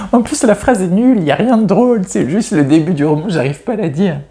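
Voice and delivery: high-pitched, laughter-filled voice; giggling. Real, not acted. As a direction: protest while recovering from a laughing fit. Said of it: "je rigole parce que". (en rigolant, voix aiguë)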